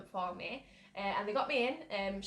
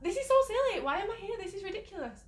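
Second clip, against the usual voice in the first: much higher, louder, exasperated scolding voice; logical inner voice; 11 semitones higher, 4 dB louder than usual. Retold or imitated. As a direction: play your sensible side scolding you, high and sharp. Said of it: "my logical side would take over".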